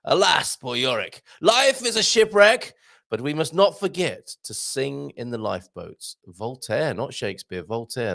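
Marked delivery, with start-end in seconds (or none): 0.0-6.2 Shakespearean pose. Acted. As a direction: declaim like a Hamlet on stage. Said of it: "yes, Shakespearean pose".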